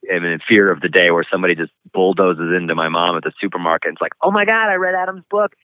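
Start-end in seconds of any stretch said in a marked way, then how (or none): 4.2-5.5 imitating an excited acquaintance in a much higher voice; gushing shopper. Somebody bumps into his mom at supermarket, mock high-pitched gushing.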